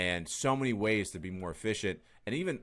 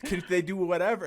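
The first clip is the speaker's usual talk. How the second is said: high, laughing, couldn't-care-less dismissal; careless brush-off; 10 semitones higher, 8 dB louder than usual. Retold or imitated. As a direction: laugh it off like you don't care at all. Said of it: "I'm like".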